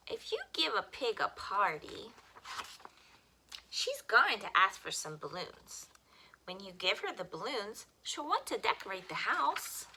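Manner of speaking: put-on bright, lively read-aloud for children; expressive picture-book reading; storytime reading. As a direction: read it like storytime for little kids.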